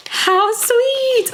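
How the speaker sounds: high drawn-out coo; real high-pitched delight; delighted gushing. Real, not acted. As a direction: gush over a sweet gift. Touched by an extra gift.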